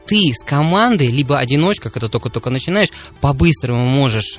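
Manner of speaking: usual speaking voice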